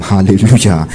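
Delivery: ordinary talk, nothing marked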